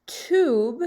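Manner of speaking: "tube" with a long oo vowel. Shown as a demonstration. Teacher models the long vowel for second graders, "tube" with oo. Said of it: "long sound for U".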